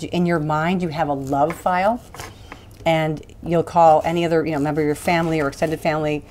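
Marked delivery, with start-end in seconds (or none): none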